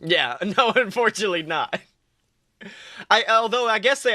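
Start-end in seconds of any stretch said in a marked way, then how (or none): none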